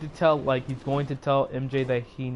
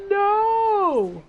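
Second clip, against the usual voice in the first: long, high held wail, hammed up; dramatic cry of no; 3 dB louder than usual. Fan reliving Tobey Maguire getting stabbed, dramatic drawn-out cry. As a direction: wail it in mock horror, drawn out. Retelling own horrified movie reaction.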